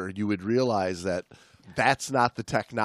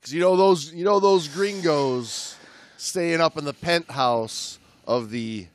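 steady read-aloud narration; book reading; 4 semitones higher, 5 dB louder than usual. Acted. Podcast host reading a diving memoir aloud, narrating. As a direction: read the passage to the listener.

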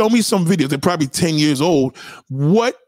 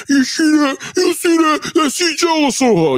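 mocking gossip; 10 semitones higher than usual. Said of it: "you're gonna be like".

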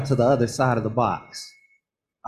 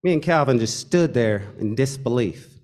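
ordinary delivery

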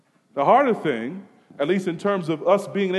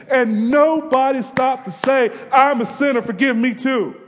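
louder, higher pulpit voice; impassioned preaching; 8 semitones higher, 5 dB louder than usual. Acted. Preacher stirring the congregation, impassioned. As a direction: preach it with mounting fire.

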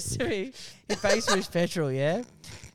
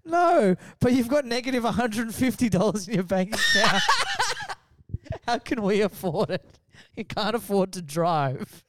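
imitating the wife; 5 semitones higher than usual. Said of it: "she goes".

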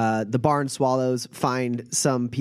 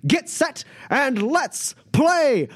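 booming announcer; 10 semitones higher than usual. Acted. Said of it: "booming voice".

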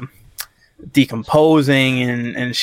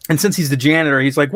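ordinary delivery

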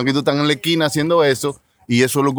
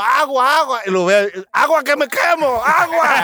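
singsong vendor call; 12 semitones higher than usual. Retold or imitated. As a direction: sing out the wares like a street seller. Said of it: "Que estoy vendiendo agua".

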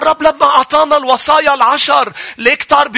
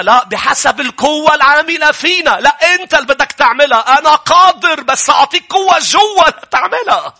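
preaching at full force; 4 semitones higher than usual. Acted. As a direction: build it up like a fiery preacher.

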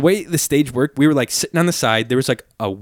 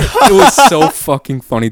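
laughing; 5 semitones higher, 8 dB louder than usual. Real, not acted. Laughing at the memory.

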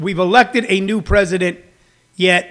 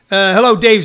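ordinary talk, nothing marked

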